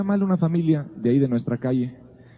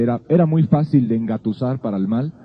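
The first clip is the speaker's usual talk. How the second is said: ordinary talk, nothing marked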